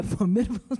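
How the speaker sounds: "formidable" stressed on MID; stress on second syllable. Shown as a demonstration. Actress quoting her line from series six, "formidable" stressed on MID. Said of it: "I could never say that word".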